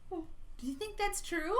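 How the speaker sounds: plain reading voice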